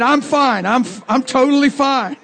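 high, defensive mock insistence; backing away nervously. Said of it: "he took three steps back and he said".

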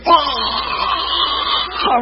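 high-pitched, drawn-out mock scream; imitated scream. Preacher imitating a possessed lady, mock scream. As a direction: imitate a woman screaming as she collapses. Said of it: "this lady goes".

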